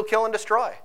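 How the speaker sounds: usual speaking voice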